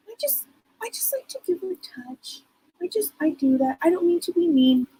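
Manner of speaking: put-on sweetly apologetic little girl; girl spirit explaining herself gently. Voicing child spirit.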